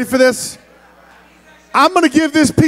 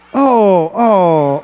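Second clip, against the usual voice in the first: normal talking voice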